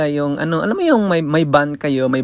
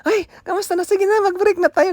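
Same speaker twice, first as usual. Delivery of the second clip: fake sweet boss. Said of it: "sabihin sa'yo".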